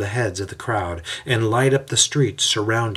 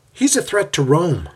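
higher, flat-out blunt statement; 8 semitones higher, 4 dB louder than usual. A narrator's reading. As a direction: state it without softening. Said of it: "Jaden says bluntly".

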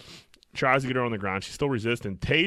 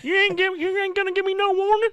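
put-on better southern accent; 4 dB louder than usual. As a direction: go all in on the Southern lady, squeal it high. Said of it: "That was better".

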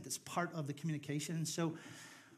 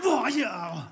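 sudden loud, higher shout; mock outburst; 6 semitones higher, 12 dB louder than usual. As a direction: blurt it out in mock outrage. Mimes an offended Irish reaction.

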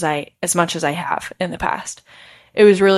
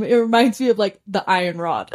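normal talking voice

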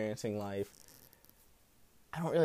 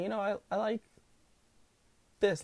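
usual speaking voice

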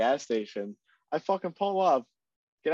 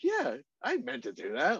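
high, playful fake excuse; sheepish excuse; 10 semitones higher, 3 dB quieter than usual. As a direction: toss it off lightly. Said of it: "could have been like".

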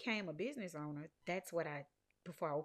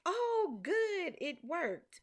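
much higher, louder burst of relief; relieved; 11 semitones higher, 5 dB louder, much slower than usual. Retold or imitated. Thinking the website is fixed, excitedly relieved. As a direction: pop up with sudden relief. Relief after fixing issue.

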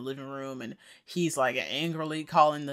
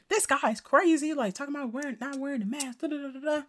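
mocking complainers; 10 semitones higher than usual. Voicing complaining customers.

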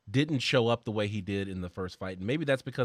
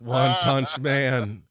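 excited; 6 dB louder, much slower than usual. Real, not acted. Excited naming the anime.

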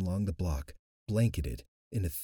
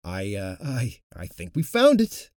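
halting, then louder on the find; stammering; 7 dB louder than usual. Acted. Luke telling the coven he found the monster, stammering. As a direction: stumble over the words in shock. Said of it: "Luke stammered".

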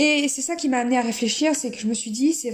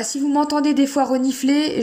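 ordinary talk, nothing marked

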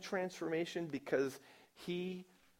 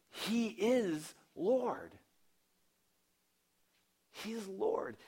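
slow, higher proclamation; proclaiming; 7 semitones higher, much slower than usual. Retold or imitated. Saul telling everyone who Jesus is, slow and proclaiming. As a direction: stretch each word out like a herald. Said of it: "Saul is going around saying to everybody".

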